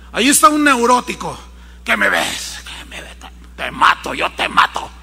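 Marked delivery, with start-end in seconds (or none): 1.8-4.9 low, breathy threatening hiss; a paranoid tough guy snarling at a stranger; menacing neurotic. Acted. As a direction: hiss the threat like an unhinged man. Voicing a neurotic man threatening.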